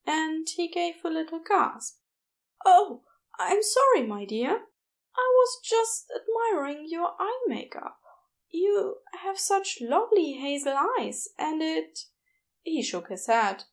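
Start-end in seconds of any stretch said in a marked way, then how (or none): 2.6-12.0 high, shy and hopeful; tentative, voiced well up high; Aziraphale's voice. A narrator's reading. Shy nurse admitting he likes the nickname, high and hesitant.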